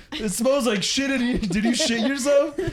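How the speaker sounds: high, accusing question; roommate voice. Retold or imitated.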